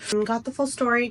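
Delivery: ordinary talk, nothing marked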